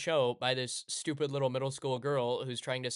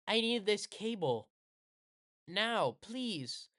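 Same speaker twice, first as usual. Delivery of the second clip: put-on higher, demanding little girl voice; mock-order the cable in a higher voice; bossy girl; 7 semitones higher, much slower than usual. Little buddy bossily sends Hina for cables, higher demanding voice. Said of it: "She's like".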